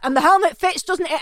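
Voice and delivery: hammed-up cheery question; boyfriend's voice. Boyfriend checking the helmet, mock cheerful. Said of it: "It's like".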